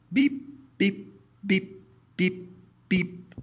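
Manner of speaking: piercing high electronic beeps; high machine beeps. A narrator's reading. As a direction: beep high and sharp like a heart monitor. Hospital machine beeping.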